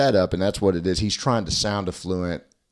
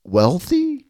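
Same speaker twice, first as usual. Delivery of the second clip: mock upper-crust voice, much higher and louder; hammed-up fake rich-guy accent; posh wealthy accent; 6 semitones higher, 4 dB louder, much slower than usual. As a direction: say it like a snooty rich person putting on airs. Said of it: "an accent for somebody who's trying to sound".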